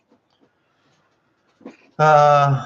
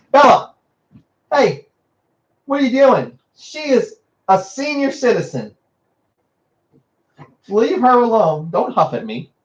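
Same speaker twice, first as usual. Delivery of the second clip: scolding the dog; 9 semitones higher than usual. Real, not acted. Owner scolding her dog Bella for bothering the old dog, high and sharp.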